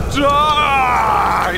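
fierce war cry; battle cry. Acted. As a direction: yell it as you attack. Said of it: "we come in here and then go".

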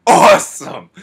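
loud, high, amazed outburst; excited whoa. Retold or imitated. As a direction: blurt it out like you just saw something amazing. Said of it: "I was like".

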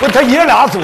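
high loud disbelieving question; shocked. Real, not acted. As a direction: blurt it out in disbelief. Shocked at grandpa duo.

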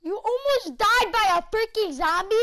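high, loud incredulous question; incredulous outburst; loud disbelief. Real, not acted. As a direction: exclaim it in exasperated disbelief. Incredulous at friend's near death.

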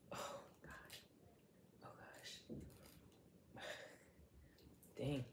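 breathless whisper while straining; strained whisper. Real, not acted. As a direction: whisper through the effort of a stuck lid. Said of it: "that took some energy".

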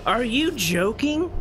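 slow, high-pitched disbelief; incredulous. Real, not acted. Gamer dismayed by the returning monster, incredulous. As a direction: drag it out, rising at the end.

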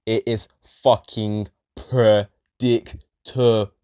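very slow, each word weighed; deliberate slow complaint; slow emphatic. Real, not acted. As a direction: drag it out in disgust.

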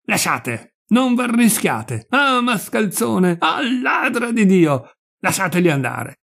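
high, slow, stretched-out angry cries; furious ranting. A narrator's reading. Nazzaro warns people off the birds, furious ranting. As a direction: rail like a man possessed. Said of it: "cominciò a sbraitare come un ossesso".

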